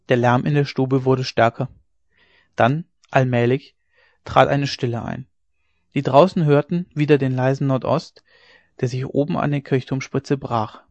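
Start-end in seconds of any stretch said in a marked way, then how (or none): none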